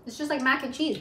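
chewing spicy food; talking between bites; mouth full. Real, not acted. Seen 1: Woman spoons red pasta into mouth at 1.0–1.6s, chews after.

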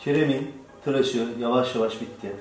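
normal talking voice

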